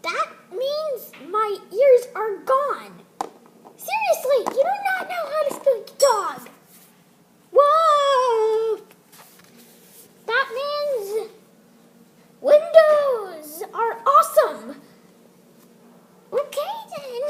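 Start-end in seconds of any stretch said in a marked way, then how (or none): 3.8-6.5 louder, higher fed-up scolding; exasperated. Acted. Exasperated dog correcting owner. 7.5-14.8 loud goofy declaration. Acted. Owner loud dog talk.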